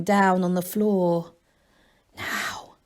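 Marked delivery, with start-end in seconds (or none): 2.2-2.7 breathy whispered word. A narrator's reading. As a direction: whisper it to a companion. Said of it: "he whispered".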